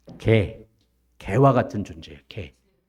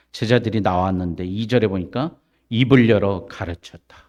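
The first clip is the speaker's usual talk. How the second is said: normal talking voice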